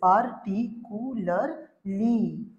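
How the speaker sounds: "particularly" very slowly as par, ti, cu, lar, ly; five separate beats on "particularly"; syllable by syllable. Shown as a demonstration. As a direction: pause after each syllable of "particularly". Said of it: "पांच बार भांग भांग करके".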